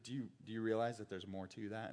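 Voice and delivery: insinuating hush. Retold or imitated. False teachers luring believers, hushed and sly. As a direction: lean in and plant a doubt.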